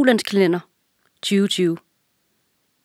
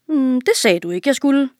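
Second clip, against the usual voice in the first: higher, naive servant voice; dim servant; 7 semitones higher than usual. A narrator's reading. Servant answers the king naively, higher voice. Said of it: "svarede den dumme tjener".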